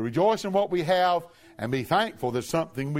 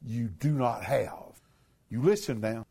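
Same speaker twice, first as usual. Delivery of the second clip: ordinary talk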